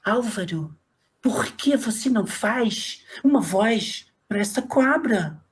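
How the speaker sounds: chefe carioca, bem arrastada; Bia Rosenberg. Retold or imitated. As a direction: imitar sotaque carioca caricato, lento e puxado. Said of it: "ela falava assim". (sotaque carioca arrastado de chefe)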